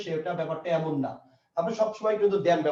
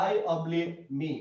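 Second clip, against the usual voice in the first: slow option read; 4 dB quieter, much slower than usual. Shown as a demonstration. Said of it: "আবার একটা option দিলাম".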